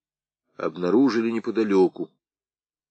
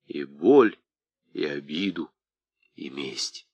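ordinary delivery

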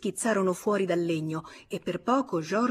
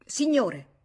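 higher call to a hidden man; 6 semitones higher, 4 dB louder than usual. A narrator's reading. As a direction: call to the man under the sheets. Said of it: "chiamò".

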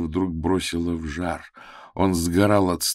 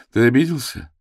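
high questioning; 8 semitones higher, 5 dB louder than usual. A narrator's reading. Lana questions silent Nikas.

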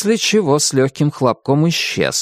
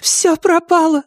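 despairing queen; 3 dB louder than usual. A narrator's reading. Queen despairs, covers face.

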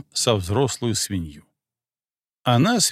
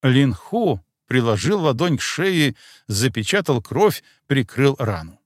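ordinary delivery

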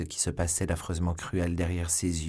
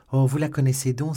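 enthusiastic cry; 5 semitones higher, 6 dB louder than usual. A narrator's reading. Novice delighted to hear of the queen, enthusiastic. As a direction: exclaim eagerly, then ease down. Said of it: "s'écria la novice avec enthousiasme".

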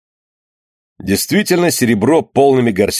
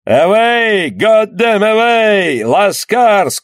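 shouting; 10 semitones higher, much slower than usual. Acted. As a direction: bellow the order as he dies. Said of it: "кричал английский капитан".

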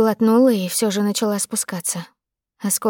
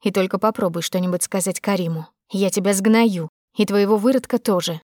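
usual speaking voice

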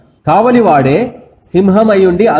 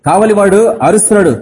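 normal talking voice

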